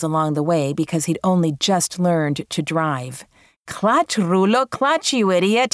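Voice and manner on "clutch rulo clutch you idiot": higher, drawn-out yelled warning; mild mock yell. A narrator's reading. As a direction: imitate mother yelling at a new driver, stretched out. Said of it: "she yelled".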